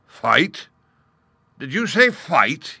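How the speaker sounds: loud, high-pitched disbelief; incredulous. Acted. Lion answering the gnat's challenge, loudly incredulous. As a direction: throw the question out as if it were absurd.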